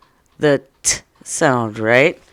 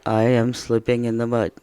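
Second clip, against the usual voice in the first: low, even, matter-of-fact reading; deliberately uninvolved and low; unexcited statement; 5 semitones lower than usual. Shown as a demonstration. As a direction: say it as if nothing is happening. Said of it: "Would you say it like that?".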